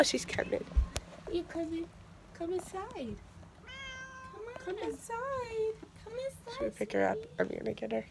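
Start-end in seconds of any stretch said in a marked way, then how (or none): 1.3-6.5 high soft coaxing voice. Real, not acted. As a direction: coax the kitty in sweetly. Coaxing a cat softly.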